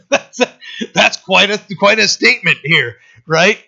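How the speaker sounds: startled laugh breaking into speech; amused. Real, not acted. Preacher reacting to a startling verse he just read, amused laugh.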